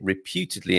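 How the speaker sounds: "reputedly" underlined with careful emphasis; stress on reputedly. Real, not acted. Quiz host hedging a claim about an author, emphasis on "reputedly". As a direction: lean on the word "reputedly". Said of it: "I do underline and emphasize the word reputedly".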